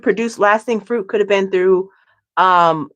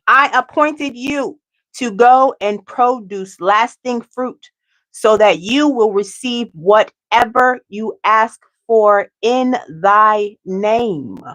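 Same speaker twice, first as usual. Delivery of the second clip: slow scripture reading, each word laid down; slowed-down solemn reread of the verse; slow, deliberate reading; much slower than usual. Shown as a demonstration. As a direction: read it again slowly and carefully. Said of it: "let me say it again".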